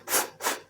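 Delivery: "ف-، ف-،" as a quiet voiceless f; just breathe "ف-، ف-،" with no voice; bare f sound. Shown as a demonstration. Teacher drilling the f sound "ف-، ف-،". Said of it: "يبقى صوت الإف ف".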